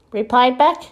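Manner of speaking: ordinary delivery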